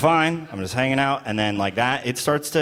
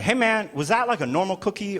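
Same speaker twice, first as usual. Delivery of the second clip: nervous questioning; 6 semitones higher than usual. Retold or imitated. Anxiously asking about the cookie.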